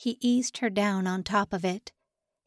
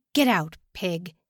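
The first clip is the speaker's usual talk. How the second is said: gruff order; 3 semitones lower than usual. A narrator's reading. Guard barks order at prisoner.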